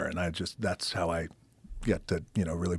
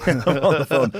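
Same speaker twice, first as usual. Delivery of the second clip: laughing through the line; chuckling as he repeats it; said with a laugh, louder; 6 semitones higher, 11 dB louder than usual. Real, not acted. Laughing repeat of phone setup.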